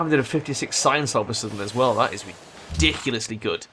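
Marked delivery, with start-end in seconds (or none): none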